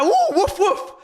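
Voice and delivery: high, laughing dog-bark imitation; mock dog barks. Fan mocking rival players as dogs, laughing barks. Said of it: "These men are like dogs".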